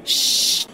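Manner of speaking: long, voiceless shush; hushing shh. Acted. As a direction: shush him so others won't hear. Shushing husband in the restaurant.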